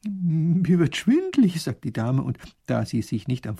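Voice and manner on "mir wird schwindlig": faint and dizzy. A narrator's reading. Lady about to faint.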